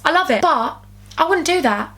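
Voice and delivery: ordinary talk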